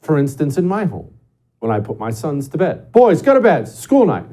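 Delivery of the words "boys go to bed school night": firm raised parent voice, higher and louder; stern dad bedtime order. Retold or imitated. Dad telling his sons to go to bed, firm raised call. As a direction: call it up the stairs like a dad who means it.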